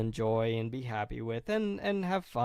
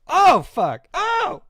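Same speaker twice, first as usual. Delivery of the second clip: loud, high cursing burst; real exasperated yell; frustrated outburst; 12 dB louder, much slower than usual. Real, not acted. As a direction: let the swear fly out. Frustration at own mistake.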